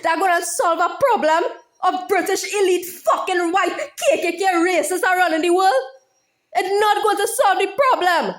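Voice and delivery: normal talking voice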